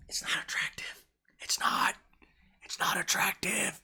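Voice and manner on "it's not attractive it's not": soft secretive whisper to the ladies; whispered verdict. Real, not acted. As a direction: whisper "it's not attractive" almost without voice. Confiding judgement to viewers.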